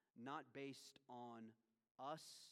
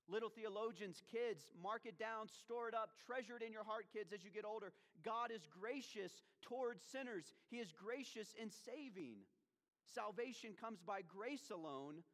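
high, raised exhortation from the pulpit; forceful preaching; 8 semitones higher, 4 dB louder than usual. Real, not acted. Preacher tells kids to treasure it in their hearts, forcefully.

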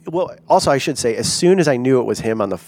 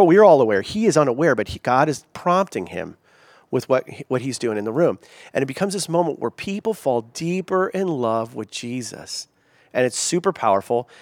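ordinary talk, nothing marked

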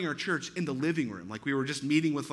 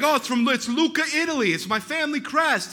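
put-on higher, louder Chicago mobster accent; 8 semitones higher, 5 dB louder than usual. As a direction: play the proud Chicago mobster. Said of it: "he sounds like a mobster".

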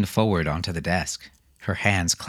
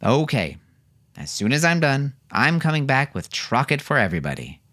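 declaring; 7 semitones higher than usual. A narrator's reading. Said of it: "Roland declared".

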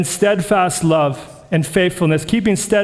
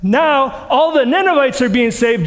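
ordinary talk, nothing marked

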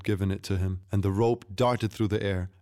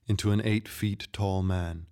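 usual speaking voice

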